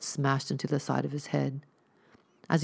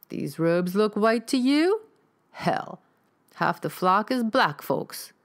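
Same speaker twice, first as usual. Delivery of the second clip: scolding rifleman; 6 semitones higher, 4 dB louder, slower than usual. Acted.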